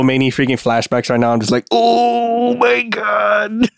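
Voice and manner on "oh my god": high, very slow oh my God; long exasperated groan of oh my God; drawn-out exasperation. Retold or imitated. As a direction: stretch it out in disbelief. Recalling flashbacks, exasperated.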